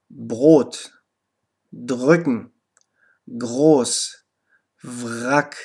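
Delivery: voiced throaty R in "Brot", "drücken", "groß", "Wrack"; low, separate model words, keep the R voiced; R after voiced consonant. Shown as a demonstration. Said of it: "dann wird es auch R".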